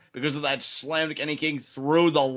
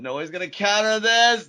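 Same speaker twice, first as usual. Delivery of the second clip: excited; 6 semitones higher, 5 dB louder than usual. Real, not acted. Repeats call with rising excitement.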